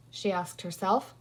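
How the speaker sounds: usual speaking voice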